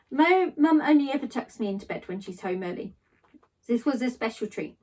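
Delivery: ordinary delivery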